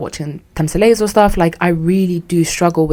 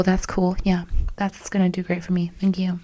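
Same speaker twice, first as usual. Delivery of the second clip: soft, dry sarcastic aside; sarcastic mutter; 9 dB quieter than usual. Retold or imitated. Niece muttering sarcastic thanks to the aunt, softer. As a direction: mumble the thanks bitterly under your breath. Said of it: "I'm like".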